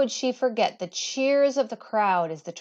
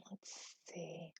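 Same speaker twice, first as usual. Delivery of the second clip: whispered aside; 2 semitones lower, 24 dB quieter than usual. Real, not acted. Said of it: "reader's aside whispered".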